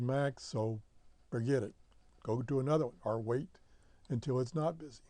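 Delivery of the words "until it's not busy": usual speaking voice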